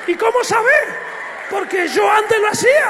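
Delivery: high, loud cry of alarm; alarmed wife. Retold or imitated. Wife asking how they'd know, in a loud alarmed cry. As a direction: shout it like she's scared for them.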